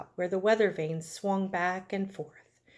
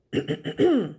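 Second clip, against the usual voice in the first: loud, deliberate throat clear; acted ahem to get ready to speak; 2 semitones higher, 6 dB louder than usual. A narrator's reading. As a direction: clear your throat before an announcement. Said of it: "So Wilbur cleared his throat".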